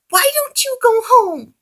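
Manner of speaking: high, loud angry pig voice; angry demand. A narrator's reading. Piggy angrily orders Oliver home, high and loud. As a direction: tell him off sharply. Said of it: "Piggy demanded".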